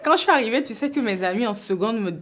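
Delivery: talking through real laughter; laughing. Real, not acted. Laughing about losing her accent. (en riant aux éclats)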